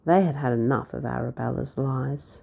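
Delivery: ordinary delivery, nothing marked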